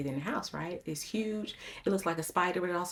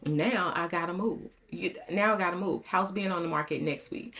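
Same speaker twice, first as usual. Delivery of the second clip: usual speaking voice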